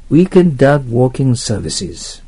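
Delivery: Weekend dog walking services read slowly and loud; slow emphatic reading. Shown as a demonstration. Teacher stresses the test answer for students, slow and emphatic. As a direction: lean on each word as the test answer.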